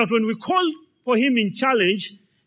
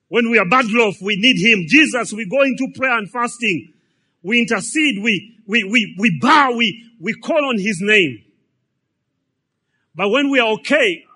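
louder, driving preacher cadence; fervent preaching; 3 semitones higher, 8 dB louder than usual. Acted. The preacher telling the church how they cry to Jesus in need, in a loud fervent preaching voice. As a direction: pound out each line to the congregation.

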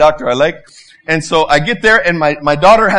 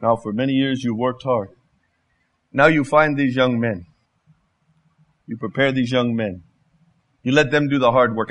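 lower, softer voice of God, unhurried pauses; quiet, lower God voice with long pauses; God's voice; 6 semitones lower, 4 dB quieter than usual. Acted. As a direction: speak as a gentle God advising. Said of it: "He said".

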